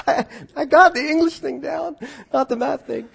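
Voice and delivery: high, laughing self-mockery. Real, not acted. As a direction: laugh through the words at yourself. Joking about his own grammar.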